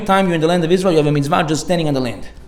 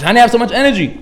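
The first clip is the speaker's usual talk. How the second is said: higher and louder, peppy; mock energetic; 6 semitones higher, 4 dB louder than usual. Student teasing the rabbi's energy, peppy mock. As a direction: bounce with fake pep.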